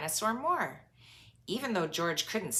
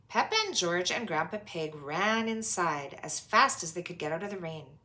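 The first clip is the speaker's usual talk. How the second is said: usual speaking voice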